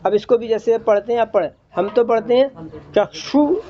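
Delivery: ordinary talk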